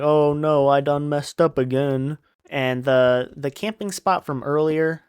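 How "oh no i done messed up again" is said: mock dismayed simpleton voice; slightly raised, dopey lament; Lenny. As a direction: bemoan a blunder in a put-on dim voice. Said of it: "So he's like".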